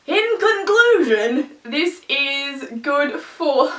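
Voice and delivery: high, loud, drawn-out proclamation; mock-grand announcement. Vlogger dragging herself back from a tangent, mock-grand. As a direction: announce it grandly, stretching the words.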